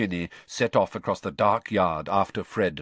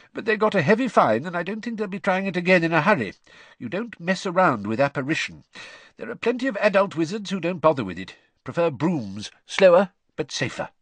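lightly voiced chatty dad; easygoing father explaining; cheery father voice; 10 semitones higher, 4 dB louder than usual. A narrator's reading. Father voice continues.

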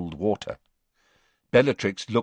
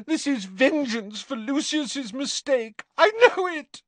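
much higher, tearful pleading; crying in earnest. Acted. Narcissa begs Snape through tears, much higher. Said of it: "began to cry in earnest".

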